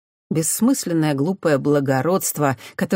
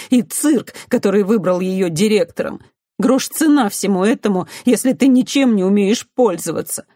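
raised, emphatic inner rant; bitter self-reproach; 4 semitones higher, 4 dB louder than usual. A narrator's reading. Humiliated girl scolds herself for being helpless, bitter self-reproach. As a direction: berate yourself with rising force.

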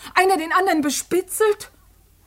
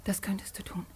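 whispered, stunned; shocked whisper; 9 semitones lower, 16 dB quieter than usual. Acted. Daughter whispering to her father in shocked disbelief. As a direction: breathe it out in disbelief.

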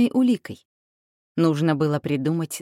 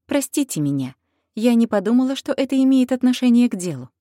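higher, louder plea for pardon; anxious pleading; 7 semitones higher, 5 dB louder than usual. Acted. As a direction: plead urgently with the king. Heroine pleads with the king.

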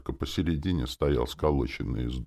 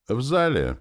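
plain reading voice

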